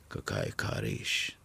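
low, slow, deep gangster voice; a hard man stating a fact; low, unhurried menace; deep tough voice. Acted. Boksor Ali answers.

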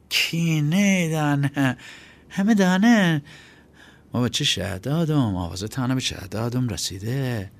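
put-on high boy's voice, starting hushed then rising; bold bluffing boy. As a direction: fake confidence, make it up on the spot. Chaghak bluffing boldly.

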